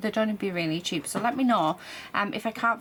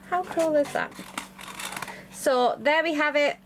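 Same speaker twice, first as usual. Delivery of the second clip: excited; 7 semitones higher than usual. Real, not acted. Reveal of demolded pieces.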